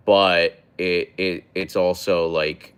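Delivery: ordinary talk, nothing marked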